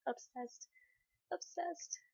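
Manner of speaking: high, pleading, near tears; desperate pleading. Retold or imitated. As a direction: beg them in a high, crying voice. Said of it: "they're just like".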